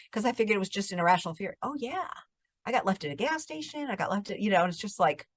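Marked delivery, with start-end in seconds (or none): 1.6-4.4 higher, soft matter-of-fact answer; soft-spoken mimicked teenager; imitated kid. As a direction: voice a naive youngster lightly.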